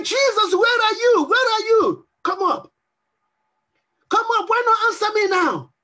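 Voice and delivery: high-pitched, urgent appeal; impatient pleading. Acted. Pressured believer asking Jesus where he is, urgently pleading. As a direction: demand an answer, pitched well up.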